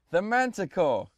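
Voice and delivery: shouting at software. Real, not acted. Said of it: "I have to shout at this thing to start work".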